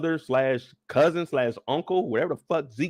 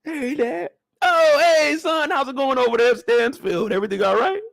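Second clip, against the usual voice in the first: high voiced phone call; 9 semitones higher, 5 dB louder than usual. Phone call with dad, acted.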